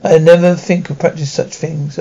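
usual speaking voice

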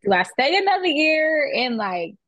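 high-pitched, drawn-out weighing up; mock deliberation. Graduate recalls weighing another year of school, mock deliberation. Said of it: "but I was like".

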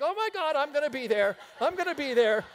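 high, eager squeal of delight; excited onlookers. Retold or imitated. Saints in heaven eager for the story, high and excited. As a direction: gasp like you can't wait to watch. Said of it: "they're like".

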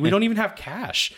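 high laughing protest. Retold or imitated. Husband laughing at crying wife, high and amused. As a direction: laugh through the words up high. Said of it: "I was like".

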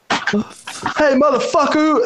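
high-pitched mock holler; retold yelling. Storyteller mimicking an unseen voice yelling at him, high-pitched holler. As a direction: imitate someone yelling in a high voice. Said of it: "I just hear someone yelling at me".

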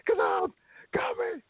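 very high, strained mock promo yell; wrestling promo imitation. Host mocks a terrible wrestling promo for his guests, hammed-up high yell.

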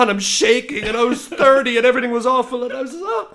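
high, overwrought mock wail; retold crying. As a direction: act out a tearful meltdown. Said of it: "officially crying".

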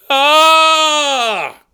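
high wounded yell; anguished wail. Shown as a demonstration. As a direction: open with a long hammy wail. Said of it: "you gotta start with a AAAHHH".

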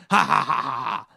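loud hammed-up villain laugh; maniacal laugh. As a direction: cackle like a cartoon villain. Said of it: "That's my maniacal laugh".

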